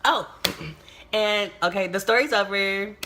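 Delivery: ordinary talk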